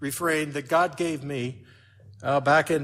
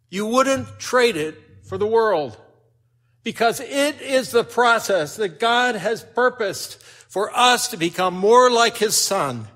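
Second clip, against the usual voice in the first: louder, higher, slow preaching swell; full-force preaching; 6 semitones higher, 7 dB louder than usual. Acted. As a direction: drive the point home to the congregation. Preaching climax on trials.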